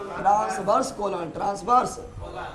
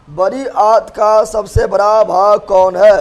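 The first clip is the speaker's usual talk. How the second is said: louder, slower call to the class; repeated louder; 9 dB louder, slower than usual. Real, not acted. Teacher re-asks the class, louder and slower. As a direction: push the question out to the whole room.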